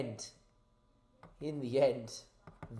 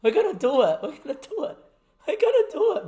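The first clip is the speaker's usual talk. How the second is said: giddy, laughing, climbing higher; real giddy laughter at the brink of victory; laughing excitedly; 9 semitones higher, 3 dB louder, much faster than usual. Real, not acted. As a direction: laugh through it as the win nears. Laughing at coming win.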